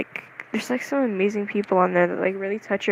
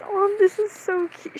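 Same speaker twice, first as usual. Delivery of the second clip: much higher, lighter gushing voice; imitated cooing; 11 semitones higher, 3 dB quieter than usual. Cosplay idol commenting on the video, cooing. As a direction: coo it as someone else's compliment.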